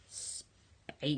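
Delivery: a secret whispered punchline. A narrator's reading. Telling the boys the joke, whispered punchline. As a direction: whisper it with a grin.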